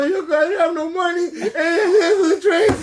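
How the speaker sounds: high, crying-through-laughter plea; mock crying. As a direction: blubber the words like a sobbing kid, half laughing. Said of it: "You know".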